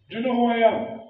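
high, loud, haughty "who am I" challenge; arrogant demand. Retold or imitated. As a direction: throw it out like a self-important big shot. Arrogant big man quoted in speech.

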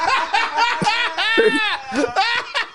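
big high-pitched laughing fit; real uncontrolled high laugh; burst of laughter. Real, not acted. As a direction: crack up in a high shriek of laughter. Laughing at the retold story.

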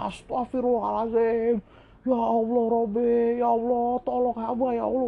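high, drawn-out panicked cry to God; terrified screaming prayer. Retold or imitated. Facing a giant creature, screaming for God's help. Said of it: "gua teriak sejadi-jadi".

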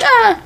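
high, short mock yelp; little high-pitched mock scream; retold scream. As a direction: yelp as if running away. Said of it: "She's like".

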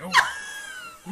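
high laughing gasp; loud gasping oh of relief; breathless whoop. Retold or imitated. Out of breath in car.

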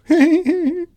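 high little giggle; girlish giggle. Acted. Goldilocks giggles high after breaking the chair. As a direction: giggle like a naughty child. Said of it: "giggled Goldilocks".

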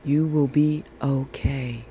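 usual speaking voice

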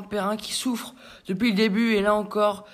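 ordinary delivery

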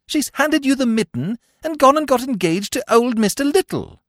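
high-pitched, breathless-sentence blurting; agitated upper-class chap. Acted. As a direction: blurt out the bad news, very high. Bertie blurts out the news.

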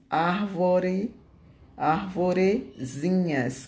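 "Árvore", pause, then "arvorezinhas" in drawn-out parts; stretched out for pupils to write; slow dictation. Shown as a demonstration. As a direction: dictate the word piece by piece. Teacher dictates the diminutive in parts.

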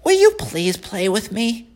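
high, whiny piglet voice; a disappointed young pig. Acted. Wilbur asks the lamb.

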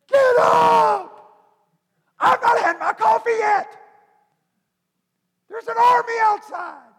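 high, loud frantic yelling; frantic shout. Retold or imitated. Servant yells at sleeping Elisha, high panicked shout. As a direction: yell him out of bed in a panic. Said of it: "He's aggravated. He's scared to death".

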